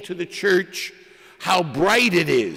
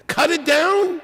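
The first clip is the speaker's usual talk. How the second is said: jumps much higher in mock disbelief; incredulous repeat; 9 semitones higher than usual. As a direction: ask it again as if the idea is absurd. Question asked twice.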